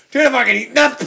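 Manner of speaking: mock-indignant spider; talking spider. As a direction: shout a demand as a tiny spider. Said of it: "You'd have a spider going".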